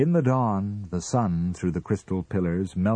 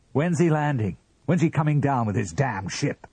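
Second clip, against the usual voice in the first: high, raised-voice questioning; angry interrogation; 8 semitones higher, 4 dB louder than usual. A narrator's reading. Husband interrogates wife about the dream man, angrily. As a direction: fire the questions jealously.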